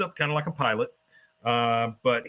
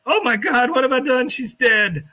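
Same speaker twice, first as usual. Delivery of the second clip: much higher, louder hysterical panic; panicked shriek; 12 semitones higher, 7 dB louder than usual. Retold or imitated. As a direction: shriek in horror. Luann thinks dog died.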